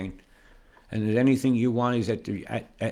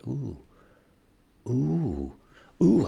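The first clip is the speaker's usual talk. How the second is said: delighted ooh; 3 semitones higher, much slower than usual. Real, not acted. Discovers something on map.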